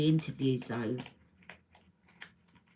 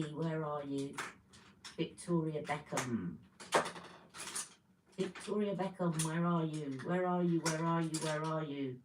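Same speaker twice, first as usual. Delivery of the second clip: very soft talking to herself; searching murmur; 11 dB quieter than usual. Real, not acted. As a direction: murmur absently to the thing you are hunting for. Talking to a lost lip liner.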